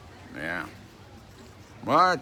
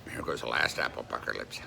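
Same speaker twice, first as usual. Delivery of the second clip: tender; 9 semitones lower, 4 dB quieter than usual. Real, not acted. Tender to pet.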